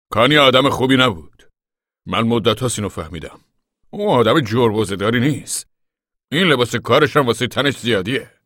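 loud, put-on voice of a disapproving father; scornful father. Pa runs down Connie to the family, scornful. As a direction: pronounce a harsh verdict, loudly. Pa's voice in the story.

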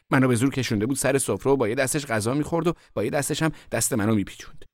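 usual speaking voice

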